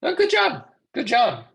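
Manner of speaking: high, excited cry of good job; bright mock praise. Praising a student's job sign, excited and high. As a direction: cheer the student on, hammed up.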